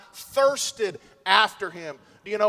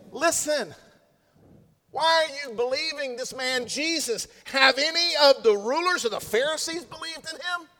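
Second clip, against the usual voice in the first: higher, sneering religious elite; haughty Pharisee; 6 semitones higher than usual. Acted. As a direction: talk down to the officers. Pharisees voiced, paraphrase.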